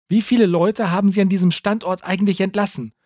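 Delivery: laut gerufen gegen den Lärm draußen; über den Lärm hinweg. A narrator's reading. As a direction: die Frage über das Gebrüll hinweg rufen. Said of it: "fragte sie Takis über den Lärm hinweg". (Reporterin ruft Takis gegen den Lärm zu, laut)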